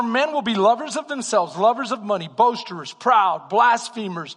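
loud, emphatic sermon list; forceful preacher reading scripture; preaching a list. Acted. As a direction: hammer each word to the congregation.

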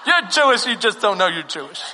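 insistent New York deli lady; New York accent. Retold or imitated. Deli lady contradicting her customer, insistent New York accent. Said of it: "in this New York accent".

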